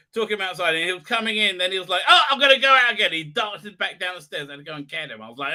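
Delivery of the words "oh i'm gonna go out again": high, loud, fast voice for a cat; excited cat. Retold or imitated. Cat bolting back outside, quick and excited. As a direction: blurt it out like a cat making a dash.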